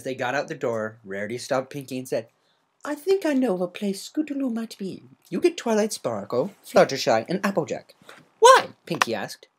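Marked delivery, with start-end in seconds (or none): 2.8-7.9 a polite lady explaining gently; Rarity voice. Acted. 8.4-8.7 much higher and louder, confused; a bubbly pony caught off guard; squeaky party pony, puzzled; Pinkie Pie voice. Acted.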